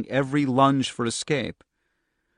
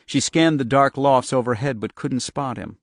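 plain reading voice

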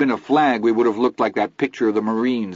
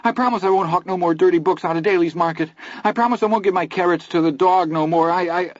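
much higher, fast panicky pleading; frantic promises; 10 semitones higher, 3 dB louder than usual. A narrator's reading. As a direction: bargain with God in terror. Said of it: "in the extremity of his fear".

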